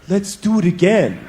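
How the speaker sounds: slow, a touch creepy. Retold or imitated. Woman asks her worn-out partner again, slow mild creepy tone. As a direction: say it slowly, lightly creepy. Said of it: "Like, creepy like that".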